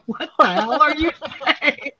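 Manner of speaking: high, amused disbelief breaking into a laugh; laughingly retold question to a babbling bird; mock bafflement. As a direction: ask it through rising laughter.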